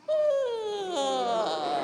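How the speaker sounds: a big stretching yawn sound. Shown as a demonstration. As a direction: open wide and yawn it out. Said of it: "a yawn and stretch".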